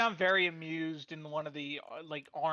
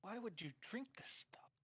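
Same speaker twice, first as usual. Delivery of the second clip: hushed whisper; 5 semitones higher, 16 dB quieter than usual. Acted. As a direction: whisper it, aside. Character whispers in scene.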